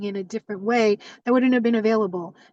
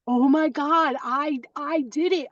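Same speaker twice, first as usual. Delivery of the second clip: higher, thrilled replay of the moment; excited disbelief; 5 semitones higher than usual. Retold or imitated. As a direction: relive the joy of a win. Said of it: "and I was beyond".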